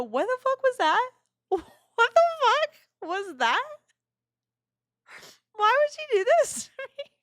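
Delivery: very high, squeaky crying voice, half laughing; hammed-up sobbing squeal; mock tearful outburst. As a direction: wail it in disbelief, imitating yourself in tears. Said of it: "I'm crying".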